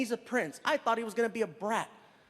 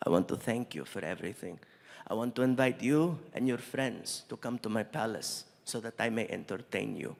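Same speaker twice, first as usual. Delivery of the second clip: formal prince; 10 semitones lower than usual. Retold or imitated. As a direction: extend a royal invitation, deep and measured. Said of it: "He looks at me and he says".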